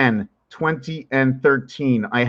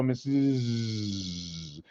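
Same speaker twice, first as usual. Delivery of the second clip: "Missus" dropped much lower and much softer, falling; going down; 6 semitones lower, 13 dB quieter than usual. Shown as a demonstration. Showing the cat how "Missus" sinks low and soft. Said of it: "It's like going down".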